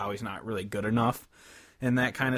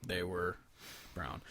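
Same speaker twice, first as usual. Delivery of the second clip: normal talking voice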